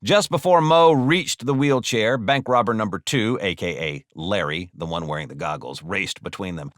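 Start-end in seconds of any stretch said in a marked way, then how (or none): none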